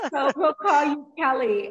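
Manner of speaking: loud, amused laugh through the words. Real, not acted. Host settling what to call her guest, laughing. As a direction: talk while still laughing.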